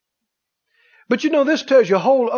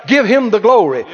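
ordinary talk, nothing marked

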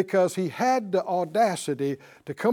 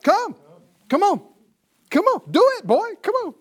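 high, quick-fire coaxing calls; unhesitating invitation; 12 semitones higher, 4 dB louder than usual. Acted. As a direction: eagerly urge him out, pitched way up. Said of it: "with no hesitation, no other requirements".

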